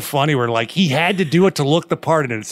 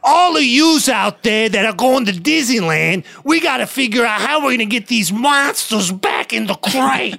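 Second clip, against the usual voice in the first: much higher, brash cartoon raccoon; Rocket Raccoon voice; 9 semitones higher than usual. Rocket addressing Disneyland visitors, high brash voice. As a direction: call out to the crowd as a raccoon.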